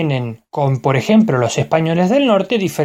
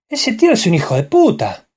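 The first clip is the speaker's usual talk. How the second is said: Argentine lilt, higher with swooping stresses; Argentine accent; 4 semitones higher than usual. Shown as a demonstration. Showing how an Argentine would say the same phrase, Argentine accent. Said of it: "Y el argentino diría".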